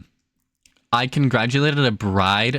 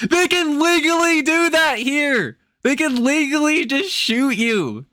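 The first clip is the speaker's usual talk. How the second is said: high, slow, outraged disbelief; high-pitched appalled whine; incredulous squeal; 12 semitones higher, slower than usual. Acted. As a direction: stretch each word in shocked disbelief. Mock outrage at US law.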